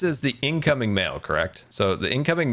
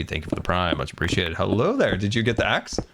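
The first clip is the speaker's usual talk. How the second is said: ordinary talk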